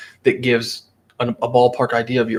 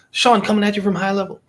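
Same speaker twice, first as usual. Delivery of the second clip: higher-pitched imitation of a video intro; Sean Clark; 8 semitones higher, faster than usual. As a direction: imitate a creator's pitched-up signature opener. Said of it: "Sean Clark, the guy who owns High Level".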